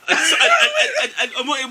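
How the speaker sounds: ordinary delivery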